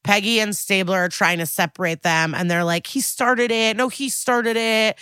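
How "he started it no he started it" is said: high, mock childish blame-trading; grade-school squabble. Recapper mimicking men fighting over a baby, mock childish squabble. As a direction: imitate two kids bickering, pitched up. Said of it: "very grade school vibes now".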